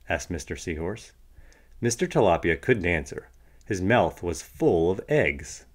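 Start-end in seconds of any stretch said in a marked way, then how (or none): none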